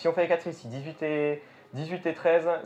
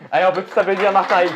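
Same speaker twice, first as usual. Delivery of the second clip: showman call for applause; 4 semitones higher, 9 dB louder, faster than usual. Pretend. Calling for applause.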